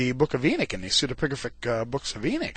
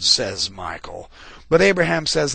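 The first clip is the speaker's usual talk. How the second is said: usual speaking voice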